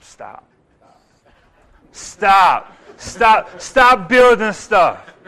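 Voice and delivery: loud firm repeated stop, slowing down; commanding stop. Retold or imitated. God ordering Peter to stop building. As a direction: cut him off firmly.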